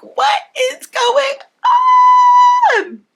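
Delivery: high-pitched shocked shriek, drawn out slowly; shocked exclaim. Retold or imitated. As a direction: squeal it out in disbelief. Mother praises her, she exclaims.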